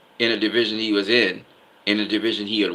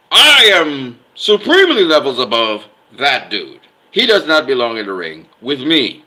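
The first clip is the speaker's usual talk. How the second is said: emphatic boast; 4 semitones higher, 8 dB louder than usual. Retold or imitated. Voicing the winner's statement to the boxing world, emphatic boast. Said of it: "That is saying".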